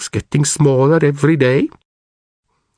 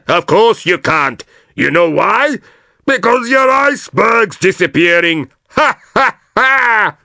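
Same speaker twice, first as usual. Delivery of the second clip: cheeky walrus voice; 6 semitones higher than usual. Acted. Cheeky walrus taunting the bear.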